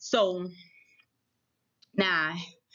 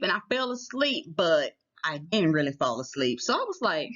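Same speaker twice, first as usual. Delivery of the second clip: normal talking voice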